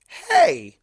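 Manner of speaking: sudden high yelp; a hammed-up yelp of hurt pride; dramatic offended cry; mock-wounded exclamation. A narrator's reading. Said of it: "Discord exclaimed, clutching at his heart dramatically".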